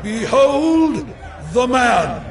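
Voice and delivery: high shouted announcement, broken by a pause; proclaiming Pilate. A narrator's reading. Pilate showing the prisoner to the crowd, shouted proclamation.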